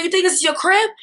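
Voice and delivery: retold angry challenge; furious challenge. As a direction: confront her in a rage. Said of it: "I was like".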